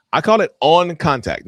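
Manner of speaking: "on" punched high, loud and drawn out; stress on "on". Shown as a demonstration. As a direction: hit "on" hard, slowing for the term. Coins term against "no contact.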